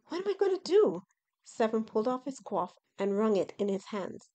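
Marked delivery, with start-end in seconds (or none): 0.1-1.0 high, panicked cry. Acted. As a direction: panic about your fate. Severn panicking.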